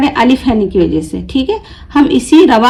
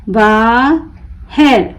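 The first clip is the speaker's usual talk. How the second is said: बाहर slowly split, long बा, pause, हर; broken into syllables; much slower than usual. Shown as a demonstration. Teacher breaking the word for learners, बा... हर with a gap. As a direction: pull बाहर apart into बा and हर. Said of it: "तोड़ तोड़ कर पढ़ूंगी".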